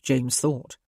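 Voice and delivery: ordinary delivery, nothing marked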